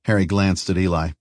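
plain reading voice

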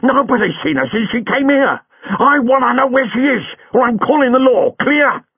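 high, loud angry cabbie; furious. A narrator's reading. Buller to Chang, furious and high. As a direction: snap at him to stop pretending. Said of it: "said Buller furiously".

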